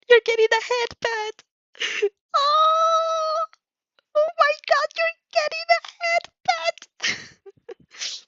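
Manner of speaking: overjoyed high-pitched shout of glee; delighted squeal. Real, not acted. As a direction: squeal with giddy delight, stretching it out. Reader squeals over head pat.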